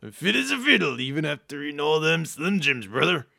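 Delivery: loud, high-strained wrestler promo voice; Macho Man. Acted. Answering the insurance caller about ailments, loud Macho Man. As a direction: boast like an over-the-top pro wrestler. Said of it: "I'm doing my Macho Man".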